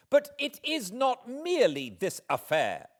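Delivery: high and loud in fury; indignant outburst. Acted. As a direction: hit it hard as she resumes. Heroine continuing her angry speech.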